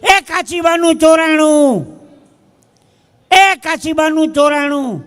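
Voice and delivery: loud, high, drawn-out cry of alarm; sudden shout. Acted. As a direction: call it out loudly across a train carriage. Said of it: "એમાં એક જણા ઓચિતાની બૂમ પાડી".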